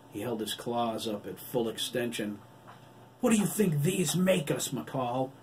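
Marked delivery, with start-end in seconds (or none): 3.2-5.3 high, loud cry cracking with emotion. A narrator's reading. Adam crying in Corny's face, voice cracking. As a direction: cry out through angry tears. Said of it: "he cried, his voice cracking with emotion".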